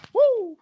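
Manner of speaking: whooping, very high and loud; real cheer of delight; excited woo. Real, not acted. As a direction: let out a triumphant whoop. Cheers at his own point.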